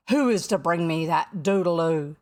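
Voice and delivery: loud, low captain's demand; thundering. A narrator's reading. Hook demands a volunteer from his dogs, thundering. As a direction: boom it out at the crew. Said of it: "he thundered".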